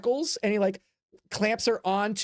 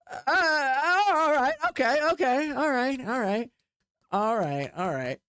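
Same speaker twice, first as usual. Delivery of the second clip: uneasy crowd; 5 semitones higher than usual. Acted. Uneasy crowd calming itself.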